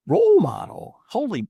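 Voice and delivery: high, loud incredulous squawk; stunned disbelief. Retold or imitated. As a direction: react astonished, voice leaping way up. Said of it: "And I thought".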